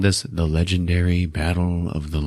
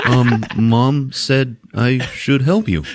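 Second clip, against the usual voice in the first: put-on slow, halting, higher kid's voice; awkward; 4 semitones higher, slower than usual. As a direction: imitate an awkward boy offering help. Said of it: "Awkwardly, Ralphie goes".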